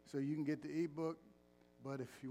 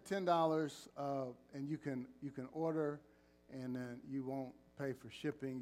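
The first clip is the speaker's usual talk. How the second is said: normal talking voice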